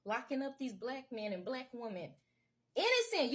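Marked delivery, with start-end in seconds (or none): 2.7-3.3 high, loud indignant burst. Real, not acted. Insisting the jailed men were innocent, indignant and loud. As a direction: fling the word out high and loud.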